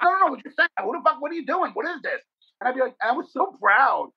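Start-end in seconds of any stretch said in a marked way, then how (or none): 0.0-2.2 high, incredulous razzing; mocking friends. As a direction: mimic the neighbourhood guys scoffing at him. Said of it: "They'd be like".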